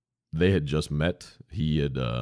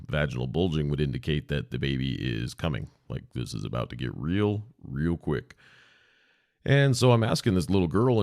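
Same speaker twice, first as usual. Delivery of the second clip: usual speaking voice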